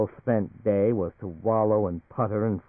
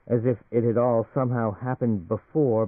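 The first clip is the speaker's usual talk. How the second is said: usual speaking voice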